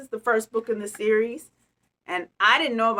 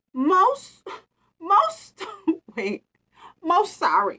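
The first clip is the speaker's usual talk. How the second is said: apologetic mouse; 8 semitones higher, 4 dB louder, slower than usual. Acted. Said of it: "said Mouse".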